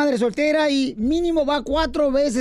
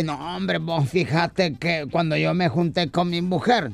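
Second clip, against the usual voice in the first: grumbling buddy; 7 semitones lower than usual. Acted. DJ complaining to his friend about his wife, low grumble. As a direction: grumble in a deep put-on voice. Plays the friend DJ telling woes.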